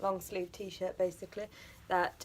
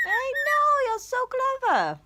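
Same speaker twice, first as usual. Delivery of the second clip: high, louder, slow cooing; 6 dB louder, slower than usual. Real, not acted. Owner praising her clever bird in high baby talk.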